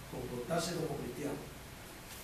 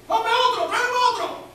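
mock yell; 13 dB louder than usual. Surgeon yelling for staff.

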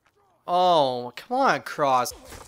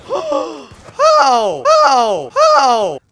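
much higher, loud screeching; shrieking how; outraged yells; 13 dB louder, much slower than usual. Real, not acted. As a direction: scream it at the screen. Outraged at game.

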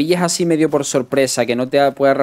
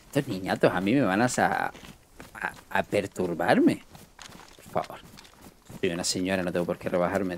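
dignified lady; 6 semitones lower, 9 dB quieter than usual. Retold or imitated. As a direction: lightly voice a lady above the insults. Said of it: "ella dirá".

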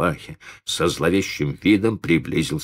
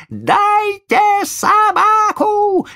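louder, high drawn-out squeal; shrieking child; 6 dB louder, much slower than usual. Acted. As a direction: scream it even harder, slowly. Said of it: "Взвизгивал мальчик".

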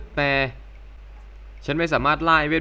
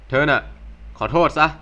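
curt demand; 3 dB louder than usual. Acted. Demanding an apology.

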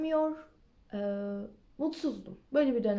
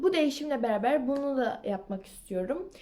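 ordinary talk

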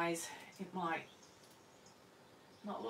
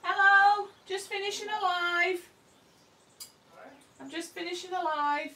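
loud call out; 6 semitones higher, 11 dB louder than usual. Real, not acted. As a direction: call out high and loud to someone arriving. Greeting someone walking in.

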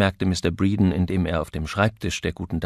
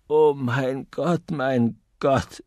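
slow, anguished moan; groaning; 5 semitones higher, 5 dB louder, much slower than usual. A narrator's reading. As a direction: groan it out in despair. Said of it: "stöhnte Mister Copley".

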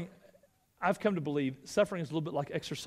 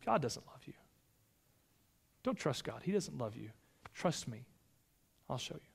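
much softer sly serpent voice; hushed tempting Satan; 4 semitones lower, 8 dB quieter than usual. Acted. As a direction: let the temptation sink almost to a hush. Satan tempting again, retold today.

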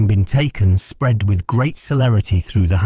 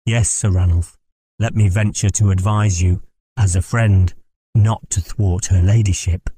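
usual speaking voice

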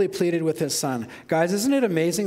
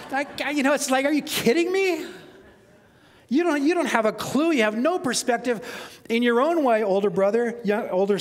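higher, incredulous father scolding a sulking son; father rebuking his older son in disbelief, higher and exasperated; 6 semitones higher than usual. Acted. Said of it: "the voice helps".

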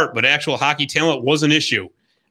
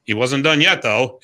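normal talking voice

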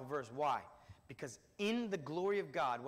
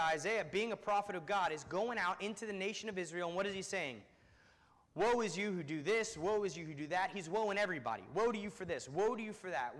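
higher preaching voice, steady drive; raised preaching; 4 semitones higher, 5 dB louder than usual. Acted. Preacher voicing the prophet's woes, raised preaching. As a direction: press Isaiah's woes on the listeners.

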